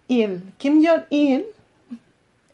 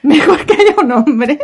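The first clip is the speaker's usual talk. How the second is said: laughing; 3 semitones higher, 7 dB louder, slower than usual. Real, not acted. Rereading friend's odd note.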